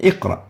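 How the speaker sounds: "اقرأ" with a light, brief bounce on qaf; soft "اقرأ", only a small echo on q; lowest qalqala. Shown as a demonstration. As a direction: lightly release the q of "اقرأ" straight into ra. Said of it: "the lowest level of qalqala".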